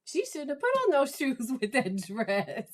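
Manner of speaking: high, sassy gossip breaking into laughter; catty teen agreement with a laugh; teenage girl. Acted. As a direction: dish it like a best girlfriend. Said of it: "channel my, my teenage girl".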